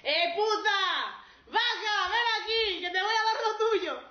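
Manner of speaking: high, very loud catcall; menacing heckle, drawn out and loud; jeering shout. Acted. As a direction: yell abuse across the street. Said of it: "le gritaban".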